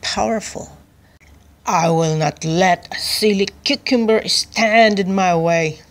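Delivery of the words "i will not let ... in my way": loud, commanding king's shout. A narrator's reading. King refusing to let a cucumber stand in his way, shouting. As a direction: shout it like an outraged ruler. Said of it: "King Cabbage shouted at his generals".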